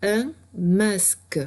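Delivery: un masque, dit lentement et détaché; mot clé détaché. Shown as a demonstration. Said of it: "le mot chef est". (mot chef dit lentement)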